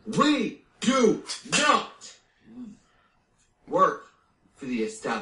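each word stressed high, with pauses: We do not work; emphatic word by word. Acted. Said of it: "I thought you were gonna slap me".

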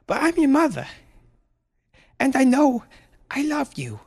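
very high, tearful-sounding stammered plea; pleading mother, distraught. Acted.

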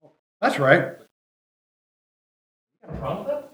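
ordinary talk, nothing marked

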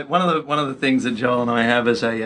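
ordinary talk

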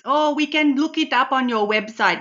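a hammed-up Indian auntie accent, full of darlings; Rani's Indian accent. Host plays Rani greeting Karen, in a playful put-on Indian accent. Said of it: "I've got Rani sitting next to me".

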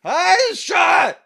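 very high, loud mock yell; drunk man screaming. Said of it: "some screaming".

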